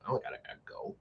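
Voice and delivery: breathy whisper, almost under the breath; whispered brush-off. Retold or imitated. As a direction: dismiss it in a hushed whisper. Brushing off mom's suggestion.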